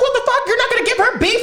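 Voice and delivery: very high, laughing protest; mock outrage. Kid arguing with mom over sister, mock outrage. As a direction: argue in a high, hammed-up outrage. Acting out heated family argument.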